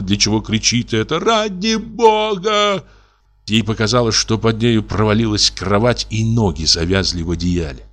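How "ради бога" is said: much higher, slow, wailing cry; strange-voiced cry. Wife in hysterics crying out, much higher, slow wail. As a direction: cry out in a voice not her own. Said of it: "кричала она не своим голосом".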